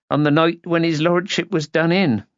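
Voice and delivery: a touch of regret; regretful. A narrator's reading. Housekeeper sorry to have missed the drama, mildly regretful. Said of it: "she added regretfully".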